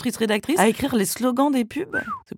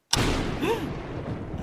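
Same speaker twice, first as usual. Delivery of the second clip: halètement exagéré; 13 semitones higher, 8 dB quieter than usual. Pretend. Halètement dramatique.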